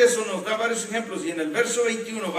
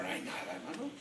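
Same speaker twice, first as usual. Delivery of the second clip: quiet retold reply; 11 dB quieter, faster than usual. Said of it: "Yo le digo".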